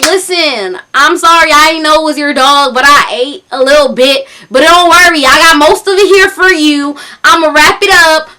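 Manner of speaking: loud mimicked country caller; thick country accent. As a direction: reassure in a put-on country twang. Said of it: "talking in this thick country accent".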